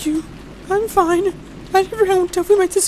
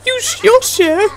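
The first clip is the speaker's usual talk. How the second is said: usual speaking voice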